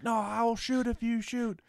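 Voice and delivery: high, hammed-up gunpoint threat; mock standoff threat. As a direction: play the tense standoff lightly. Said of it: "They're like".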